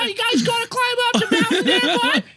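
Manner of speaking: loud, very high falsetto Mainer; piercing put-on local voice; high-pitched Maine voice. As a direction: imitate a Maine park ranger, squeaky and loud. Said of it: "with that high-pitched voice".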